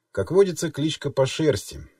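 usual speaking voice

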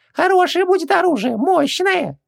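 squeaky little genie, very high. A narrator's reading. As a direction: pitch way up like a tiny genie. Genie voice held.

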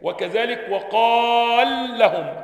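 slow, loud drilled madd in وقال لهم; lengthened madd. Shown as a demonstration. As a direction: draw out the ا of وقال before the merged لام. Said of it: "بتسكن".

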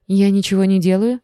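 plain reading voice

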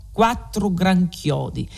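usual speaking voice

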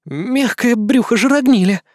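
slow, high snarling demon curse; growling. Acted. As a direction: snarl it out in frustration. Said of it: "прорычала наёмница". (рычащий голос демоницы)

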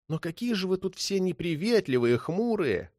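plaintive mock reproach; cheeky thief. A narrator's reading. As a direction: lament playfully on the way out. Thief complains on his way out.

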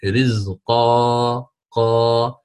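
"رزقا" slowly, ending on a long alif, no نون; stop with alif. Shown as a demonstration. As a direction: draw out "رزقا" then repeat "قا" with the alif. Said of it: "ستعوض هذه النون الساكنة بألف".

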